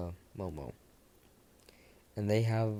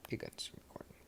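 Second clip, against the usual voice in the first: whispered aside; 12 dB quieter than usual. Real, not acted. Whispered aside to others in room.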